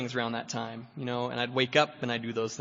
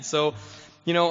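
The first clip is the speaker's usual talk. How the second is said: ordinary delivery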